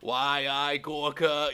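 slow, high sputtering villain pun; sputtering foiled villain. Retold or imitated. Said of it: "pun on why I oughta".

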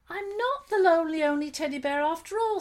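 high, loud delighted teddy; joyful teddy bear. Acted. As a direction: burst out with relief and joy. Teddy bear happy relief.

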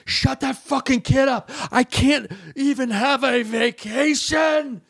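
high, slow mock shriek; hammed-up outraged screech, not full volume; imitated woman's yell. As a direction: drag it out like a hysterical passenger. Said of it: "then this woman yells".

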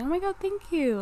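high, bright delighted thank-you. Retold or imitated. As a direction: gush like a flattered friend. Voicing friend's delighted reply.

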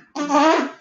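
breaking up in high, squeaky laughter; can barely get the words out for laughing. Real, not acted. Cracking up at a noise.

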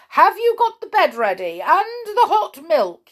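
high, loud call. A narrator's reading. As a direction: call to someone inside the house. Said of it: "he called to Patter".

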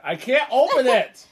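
high, loud exasperated shout; frustrated yell. Real, not acted. Struggles with bottle, yells.

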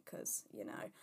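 hushed joking aside; whispered aside. Real, not acted. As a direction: drop to a conspiratorial whisper. Aside about being a millionaire.

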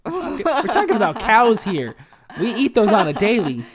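high, loud comic protest; mock outrage. Real, not acted. Co-host insisting to friend they mean cows, mock outrage. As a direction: argue it emphatically, voice pitched right up.

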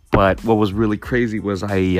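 ordinary talk, nothing marked

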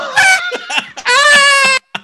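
high screaming burst of laughter; shrieking laugh. Real, not acted.

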